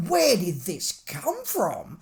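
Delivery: slow, bewildered mock question; drawn out in disbelief; slowed-down puzzled aside; baffled. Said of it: "I'm like".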